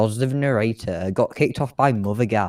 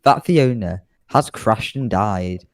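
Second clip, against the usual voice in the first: ordinary talk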